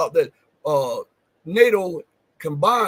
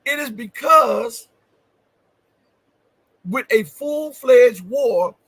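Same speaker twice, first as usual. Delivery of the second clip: slow, louder and higher on full-fledged war; shouted emphasis; 4 semitones higher, 5 dB louder, slower than usual. Real, not acted. Host driving home his point to viewers, loud and slow.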